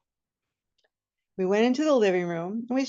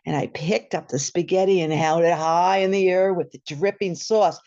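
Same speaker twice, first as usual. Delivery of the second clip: normal talking voice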